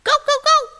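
high, loud yaps; loud dog barking. A narrator's reading. Dogs arrive at fox's door, loud barking. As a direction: bark three times, sharp and high. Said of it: "bầy chó sủa vang".

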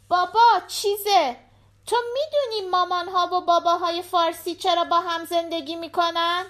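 put-on high-pitched little girl chatting to her dad; little daughter. A narrator's reading. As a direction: voice a young daughter, pitched well up. Voices the daughter. (صدای زیر دختربچه)

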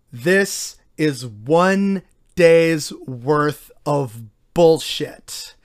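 slow, low and drawn out, worn down; slow weary emphasis. Real, not acted. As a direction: let each word drag with exhaustion. Weary summing up.

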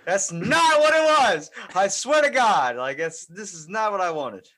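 loud, high, drawn-out protest; mock horrified shout. Listener protesting the monkey play, mock horrified shouting.

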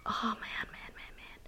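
breathy whisper, fading to near nothing; whispered to self. Real, not acted. Groping for the philosopher's name, whispered to herself. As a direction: mutter it to yourself while searching for a word. Searching for a word.

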